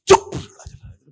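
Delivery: sharp hush dropping into whisper; urgent shushing. Retold or imitated. Brother silencing the loud boy, urgent hushed whisper. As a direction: cut him off with a whispered shush.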